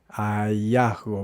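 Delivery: Hallazgo drawn out slowly as a-ja-sgo; slow Chilean hallazgo. Shown as a demonstration. Teacher slowing the word down for students. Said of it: "dice hallazgo".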